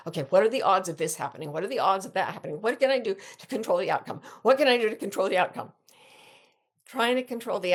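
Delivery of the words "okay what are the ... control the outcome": fast, worried rush of questions; anxious racing thoughts. Retold or imitated. A worried mind going on and on about the odds, fast and anxious. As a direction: rattle off the worries quickly, spiralling. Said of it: "going on and on in my mind".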